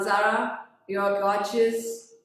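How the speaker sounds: normal talking voice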